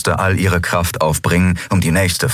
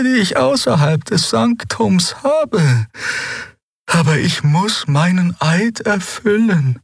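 put-on old healer Xea. Xea, the old healer, speaking high and gentle.

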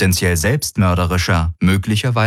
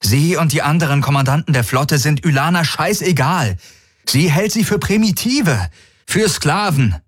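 higher voiced, strained urgent plea; strained Garland; 9 semitones higher than usual. A narrator's reading. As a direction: push the warning out under strain. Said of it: "sagte Garland angestrengt".

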